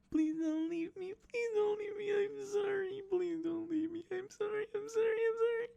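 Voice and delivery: hammed-up, squealing high plea; frantic falsetto begging; whining woman. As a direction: beg in a very high mock wail. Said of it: "each whine of this woman".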